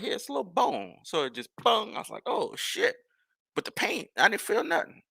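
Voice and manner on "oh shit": surprised curse. Retold or imitated. Recalls getting hit in the eye.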